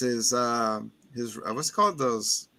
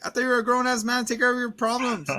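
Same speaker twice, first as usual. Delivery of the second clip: higher, fast sarcastic quip; mocking retort; 8 semitones higher, faster than usual. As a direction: scoff at him quickly. His own retort, fast and high.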